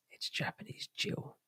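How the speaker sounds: hushed breathy secret whisper, slowed; a sly whispered correction. Acted. Whispered aside after chat joke.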